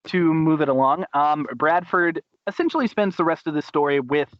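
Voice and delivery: normal talking voice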